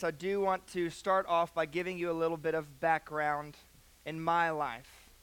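usual speaking voice

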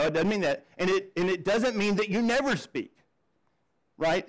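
ordinary talk, nothing marked

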